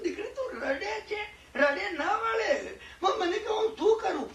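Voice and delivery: half laughing through the story of her crying; laughing while retelling. Retold or imitated. Tearful woman's plea retold.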